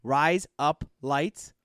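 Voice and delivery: "Rise up lights" spaced out slowly; slow model phrase. Shown as a demonstration. Giving Sway the phrase to repeat, slow model. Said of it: "really fast".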